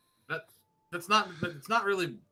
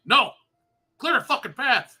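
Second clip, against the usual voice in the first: loud, high, emphatic order; acted-out panicky demand, loud and slowed for emphasis; urgent shout; 6 semitones higher, 8 dB louder, slower than usual. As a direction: push through the crowd with your voice. Acts out urgently leaving a crowd.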